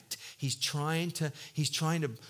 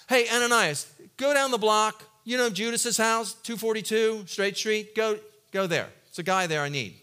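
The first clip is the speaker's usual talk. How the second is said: casual directions; 5 semitones higher, 5 dB louder than usual. Retold or imitated. God casually directing Ananias.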